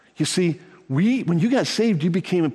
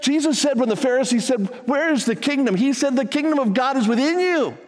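full-force preaching; 9 semitones higher than usual. Acted. Preacher telling the congregation the kingdom is within them, fervent. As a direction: preach it out to the whole church.

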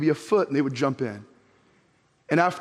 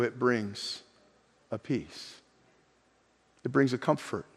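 much softer, lower, hushed; 7 semitones lower, 9 dB quieter than usual. Real, not acted. Preacher to congregation about God's peace, hushed. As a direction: let it drop to a near hush.

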